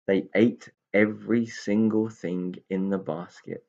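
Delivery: usual speaking voice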